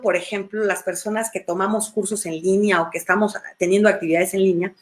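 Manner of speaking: normal talking voice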